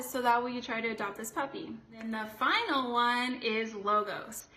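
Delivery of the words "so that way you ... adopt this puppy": usual speaking voice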